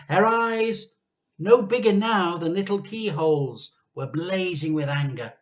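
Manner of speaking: ordinary delivery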